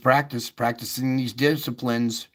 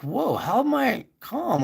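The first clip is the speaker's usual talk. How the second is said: high, amazed little whoa; surprised wonder; 10 semitones higher than usual. Retold or imitated. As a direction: marvel at your own calm, imitating. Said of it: "And you're like".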